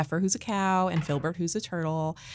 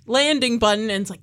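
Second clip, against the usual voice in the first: ordinary talk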